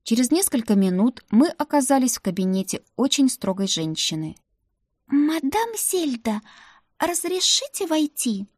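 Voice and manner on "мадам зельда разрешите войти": put-on high, slow timid girl's voice; timid Ruta. Maid Ruta asking the strict madam to enter, timid and high. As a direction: ask permission meekly, pitched up. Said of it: "начала тихим голосом Рута".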